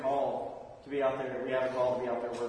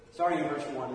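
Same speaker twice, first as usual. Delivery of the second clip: usual speaking voice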